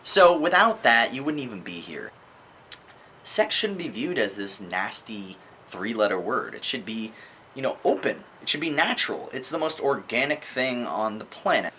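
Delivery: usual speaking voice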